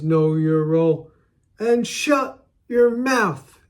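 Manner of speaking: Rock catchphrase. Retold or imitated. Fan quoting the Rock's put-down, hammed-up drawl. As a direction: stretch out the Rock's famous put-down. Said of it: "The Rock says".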